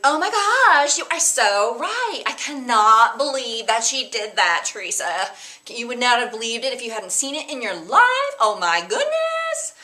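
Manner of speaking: put-on high, loud valley girl gush. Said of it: "talk kinda like a valley girl".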